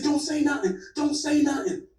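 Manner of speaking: imitating frightened plea. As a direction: beg him, scared, in a retold voice. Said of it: "He said".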